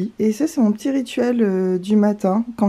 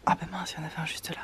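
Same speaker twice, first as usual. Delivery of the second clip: hushed whisper to not scare birds; 18 dB quieter than usual. Real, not acted. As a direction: whisper softly, sneaking up. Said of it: "Faut que je m'approche doucement".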